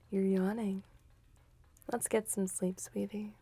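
normal talking voice

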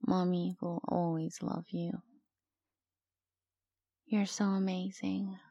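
ordinary talk, nothing marked

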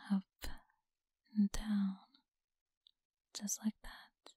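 teasing close whisper; breathy whisper to a listener. Acted. Whispered cuddling.

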